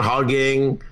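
ordinary delivery

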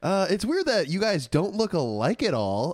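puzzled questioner. Pretend. Playing questioner of supposed twins.

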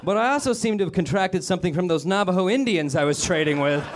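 high put-on voice of a plague sufferer; a hammed-up pioneer fretting; mock old-timey customer. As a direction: play a frontier settler fretting about plagues. Comedian voices a pharmacy customer.

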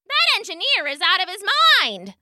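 high-pitched mild story shout; passenger's shout. A narrator's reading. Nearly knocked-over passenger to the crowd, high mild shout. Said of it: "shouted a passenger".

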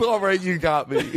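laughing confession. Real, not acted. Host caught doing a commercial, laughing. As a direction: crack up as you give in.

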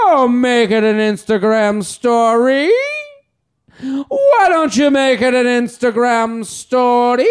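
slow drawn-out whiny plea; fun voice. Shown as a demonstration. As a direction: wail it like a begging cry. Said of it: "That'd be a fun voice".

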